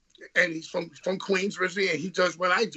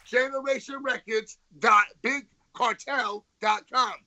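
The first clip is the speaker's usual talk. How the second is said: upbeat merch plug, slightly raised; ad read; 4 semitones higher than usual. Acted. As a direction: sell it like a radio ad. Plugs merch like an ad.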